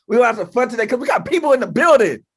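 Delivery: hype host. Real, not acted. Hyping up the audience.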